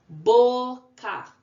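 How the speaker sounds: Boca called out loud and high, Bo, then ca; syllable by syllable. Shown as a demonstration. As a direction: punch a long Bo, pause, then ca. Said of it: "Duas sílabas".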